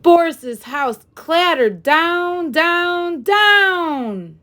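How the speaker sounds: usual speaking voice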